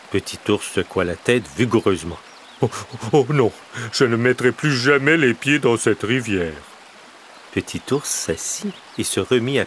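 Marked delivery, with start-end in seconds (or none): 2.6-6.5 little bear refusing, a touch louder; mild fearful refusal in a bear voice; frightened little bear. A narrator's reading. Little bear swearing off the river, mildly fearful.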